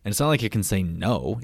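ordinary delivery

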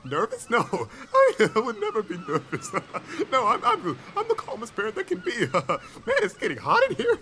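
high-pitched nervous laughter through the words; nervous denial. Acted. Dad denying his nerves to the professor, nervously laughing. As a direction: laugh it off, badly faking calm. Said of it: "You got every right to be nervous".

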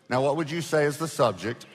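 ordinary delivery

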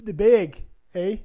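lightly hammed Canadian fan cheering. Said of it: "They're gonna be so".

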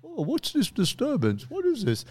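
put-on high fussy old-person voice; fussy elderly busybody; old neighbors. Old neighbors with coffees, fussy busybody voice. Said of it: "old people coming out".

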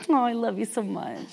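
soft, hushed, fond, a hint of God; God's tender voice. God answering her prayer, a soft tender hint. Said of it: "he's like".

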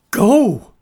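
high, loud furious shout. A narrator's reading. Frightened girl yelling at the monster, loud shout. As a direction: scream it at the monster to make him leave. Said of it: "she shouted".

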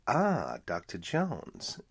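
bad German accent. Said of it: "in the bad German accent and all".

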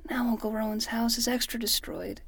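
whispered in defeat; dejected whisper. Acted. Boy whispers sadly about the ruined house. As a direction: breathe it out, crushed.

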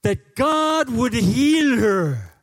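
put-on very high, loud, slow pleading in a Spanish accent; Spanish accent impression. Sonny begs Pastor Mike to lay hands on Erica, in a Spanish accent. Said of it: "my Spanish impersonation".

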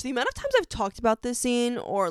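ordinary talk

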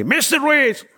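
high yelled name across the booth; retold yell. Airport officer calling the traveler at the booth, a high yell. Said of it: "the lady yelled at me".

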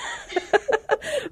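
high burst of giggling; real laugh. Real, not acted. Mom laughing at being the crazy mom. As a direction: breaks into a high cackle. Laughing at her own story.

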